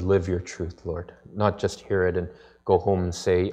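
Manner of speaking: soft, low, hushed prayer voice; a gentle, reverent pastor's prayer. Acted. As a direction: pray quietly and humbly to God.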